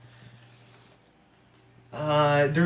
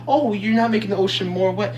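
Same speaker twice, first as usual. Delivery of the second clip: higher-pitched mock objector; mock objection; 6 semitones higher, 4 dB louder than usual. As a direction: imitate a naive objection, pitched up. Mimicking an opposing argument.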